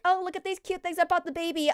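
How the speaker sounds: put-on high, gushing baby-shopping delight; mock gushing excitement. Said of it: "It's gonna be like".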